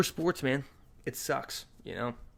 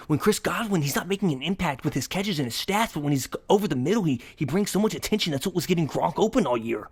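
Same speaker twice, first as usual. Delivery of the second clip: higher, breathless-paced sports rant; reenacted rant; 5 semitones higher than usual. Fan making one last point to his girlfriend, worked-up retold rant. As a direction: argue the point in a pitched-up voice.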